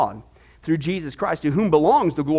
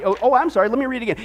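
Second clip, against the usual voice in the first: ordinary delivery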